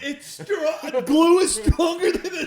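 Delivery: high, loud, laughing disbelief; incredulous. Real, not acted. Co-host hearing glue beats zippers, laughing incredulity. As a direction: blurt it out in amused astonishment. Amazed reaction to glue claim.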